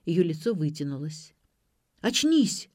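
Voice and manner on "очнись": high, urgent order to come round; sharp command. A narrator's reading. Vik shaking Ksenia awake, commanding. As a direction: hit ОЧНИСЬ hard and high. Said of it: "велел Вик".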